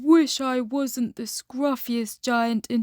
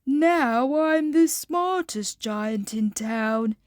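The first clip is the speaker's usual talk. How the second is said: proud; 2 semitones higher, slower than usual. Acted. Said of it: "he said proudly".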